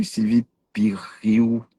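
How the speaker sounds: slow, drawn-out whisper of the name; a playful slow whisper. Real, not acted. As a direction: breathe the name slowly, teasing. Teasing greeting of viewer.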